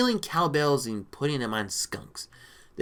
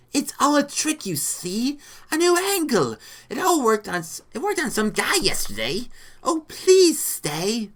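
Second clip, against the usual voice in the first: high, pleading lizard voice; lizard man; 10 semitones higher, 5 dB louder than usual. A narrator's reading. Lizard man begging Rita to stay, pleading. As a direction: plead with raised pitch, drawing out the end. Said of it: "Oh, it was the lizard".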